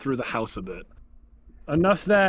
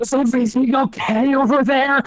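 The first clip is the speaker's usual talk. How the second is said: louder retelling of the call; loud imitation; 10 semitones higher, 8 dB louder than usual. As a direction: repeat the call out loud, half-shouted. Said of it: "Stage whisper".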